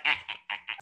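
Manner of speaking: put-on old hobo running away. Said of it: "just like".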